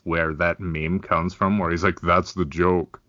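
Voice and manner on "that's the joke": deadpan Rainer Wolfcastle. Retold or imitated. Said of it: "deadpan do these terrible non-jokes".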